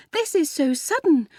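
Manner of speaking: put-on high, flustered girlish voice; overcome mutter. A narrator's reading. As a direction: say it overwhelmed, pitched high. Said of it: "muttered Ethel".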